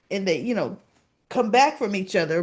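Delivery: plain reading voice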